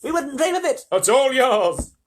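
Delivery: loud, high chorus of refusals; insistent high-pitched outcry; crying out in protest. Acted. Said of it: "the others all cried".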